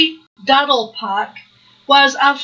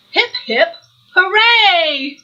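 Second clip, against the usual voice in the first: cheer; 6 semitones higher, slower than usual. Acted. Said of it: "when he added".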